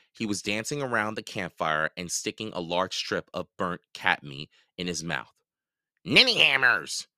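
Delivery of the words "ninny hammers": higher, louder taunt; yelled insult. A narrator's reading. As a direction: hurl the insult. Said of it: "he yelled at the other goblins".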